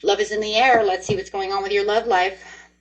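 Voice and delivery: ordinary talk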